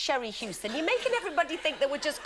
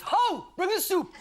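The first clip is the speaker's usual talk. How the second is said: mock grumpy husband; 4 semitones higher, 4 dB louder, slower than usual. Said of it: "He just goes".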